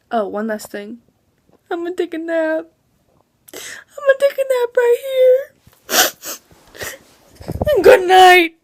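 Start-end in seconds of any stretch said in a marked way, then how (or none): 1.7-2.7 higher, sleepy mock announcement; sleepy joke voice. Jokey sleepy sign-off. 3.5-6.4 much higher whining fake sob; whiny mock cry. 7.7-8.6 loud, high laughing goodbye. Real, not acted. Laughing sign-off.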